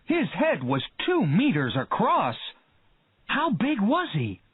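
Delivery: high, eager questions; excited. A narrator's reading. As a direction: keep the thrill going. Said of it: "cried Hooper".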